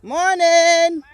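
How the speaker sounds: loud, high long call of good morning; called-out greeting. Real, not acted. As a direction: hail them from across the field. Greeting workers from a distance.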